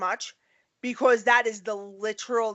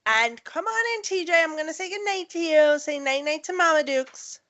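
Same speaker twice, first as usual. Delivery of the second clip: motherly coaxing; 6 semitones higher than usual. Real, not acted. Mother calls son over to say night-night, sweet and high. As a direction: coax the little one in, pitched up.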